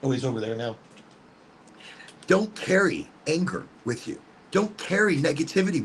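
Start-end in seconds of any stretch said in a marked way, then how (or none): none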